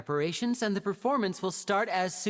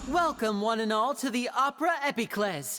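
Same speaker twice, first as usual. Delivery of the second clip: grand stage host voice, projected to the crowd; showman announcer; 3 semitones higher than usual. Acted. Magician Linny hosting the audience in a showman announcer voice. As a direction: welcome the audience like a ringmaster.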